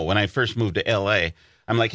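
ordinary delivery

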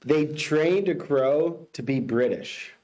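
slow, deliberate headline delivery; slow headline read. Shown as a demonstration. Host reads the headline to the co-host slowly. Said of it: "give you the headline".

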